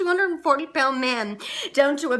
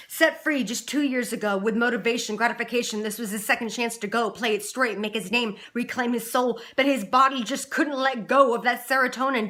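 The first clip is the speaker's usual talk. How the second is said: ordinary talk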